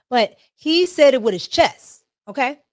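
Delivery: ordinary talk, nothing marked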